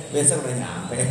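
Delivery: words through laughter; real laughter at a police story; laughing. Real, not acted. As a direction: laugh as the punchline lands.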